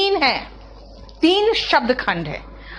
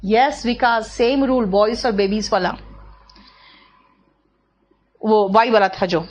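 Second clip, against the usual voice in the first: normal talking voice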